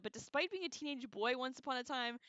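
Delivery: ordinary talk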